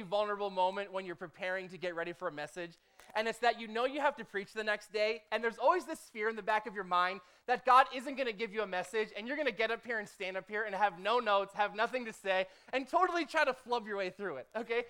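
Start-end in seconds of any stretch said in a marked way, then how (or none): none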